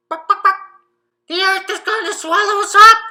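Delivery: high, loud panicked chicken cry; alarmed calling out. Acted. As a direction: shout the warning to the whole street. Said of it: "The chicken called out again".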